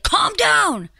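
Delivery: high-pitched, forceful order; mock yelled command. Shown as a demonstration. Showing partner how not to say it, yelled. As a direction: snap it out as an exaggerated demand. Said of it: "it depends how you say it".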